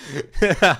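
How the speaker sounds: loud, high burst of laughter; real laugh at something absurd. Real, not acted. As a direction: crack up out loud. Laughing at the played clip.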